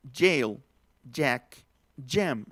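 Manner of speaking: teacher drilling jail, jack, jam; English j. Shown as a demonstration. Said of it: "simplemente se pronuncia".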